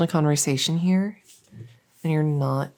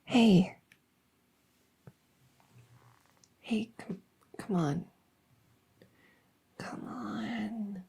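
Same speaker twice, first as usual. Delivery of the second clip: hushed, breathy calls to wake someone; a creepy bedside whisper; coaxing whisper; 6 semitones higher, 8 dB quieter, slower than usual. Acted. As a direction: whisper softly from the dark to lure them out. Monster whispering to wake child.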